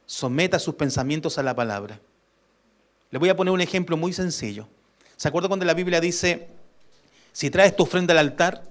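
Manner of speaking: usual speaking voice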